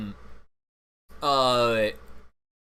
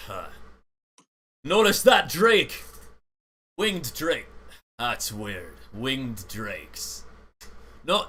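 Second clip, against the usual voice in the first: ship captain voice; 2 semitones higher, 5 dB louder, slower than usual. Acted. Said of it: "he's like".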